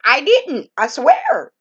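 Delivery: indignant denial. Retold or imitated. Denying blame to her relatives.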